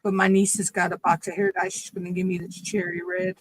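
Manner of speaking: usual speaking voice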